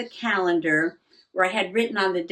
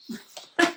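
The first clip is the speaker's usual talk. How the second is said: laugh; 10 semitones higher than usual. Real, not acted. Finds the lost calendar page.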